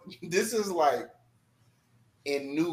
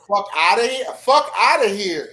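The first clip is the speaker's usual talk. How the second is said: loud, repeated fuck outta here; louder mock shout at the kid; mock trash-talk yell; 2 semitones higher, 9 dB louder than usual. Friend acting out angry trash talk.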